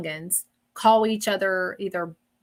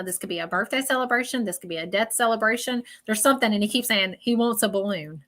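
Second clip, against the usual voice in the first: usual speaking voice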